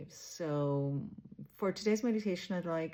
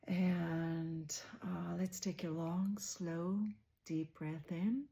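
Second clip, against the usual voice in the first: softer, unhurried meditation voice with long pauses; guided meditation; 4 dB quieter than usual. Real, not acted. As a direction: guide listeners gently inward, leaving space after each phrase.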